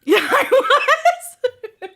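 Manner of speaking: loud, high, drawn-out laughing shout; laughing admission. Real, not acted. Friend admitting she was mad, loud laughing shout. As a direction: shriek it through real laughter.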